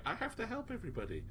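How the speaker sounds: put-on high, bubbly girly voice; bubbly Yuzu. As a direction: chirp it like a sweet eager girl.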